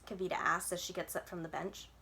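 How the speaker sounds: ordinary delivery, nothing marked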